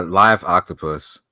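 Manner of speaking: usual speaking voice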